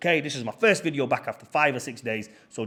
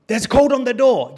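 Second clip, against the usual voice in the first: much higher and louder retort; exasperated insistence; 10 semitones higher, 10 dB louder than usual. Retold or imitated. Host insisting to a locked-out guest, exasperated. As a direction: loudly imitate a stubborn host insisting. Acted argument over door code.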